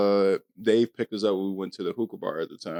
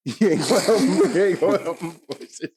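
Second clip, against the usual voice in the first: higher, laughing through the words; laughing disbelief; 9 semitones higher, 6 dB louder than usual. Real, not acted. Laughing incredulous mock.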